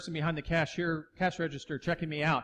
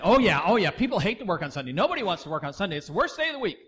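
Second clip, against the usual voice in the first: louder, fast agreeing oh yeah; amen-section manager; 3 semitones higher, 6 dB louder, faster than usual. Retold or imitated. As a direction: back her up loudly and quickly. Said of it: "her amen section's the manager saying".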